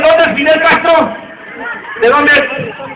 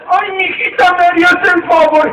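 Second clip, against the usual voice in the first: put-on creaky old man; 6 semitones higher than usual. Old man accepts eagerly.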